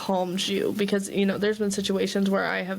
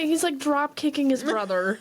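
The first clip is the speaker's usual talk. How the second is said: ordinary talk, nothing marked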